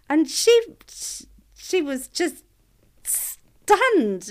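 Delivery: real tears welling up, voice catching high; voice breaking. Real, not acted. Mother reliving crying on a stranger's doorstep, voice breaking.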